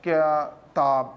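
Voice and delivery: کتاب broken in two, slow, with a gap before تاب; halting word reading. Shown as a demonstration. Teacher mimicking a child's reading for parents, halting and slow. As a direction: read کتاب haltingly in low, drawn-out pieces. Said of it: "یہ کیا اس طرح پڑھ رہا ہے".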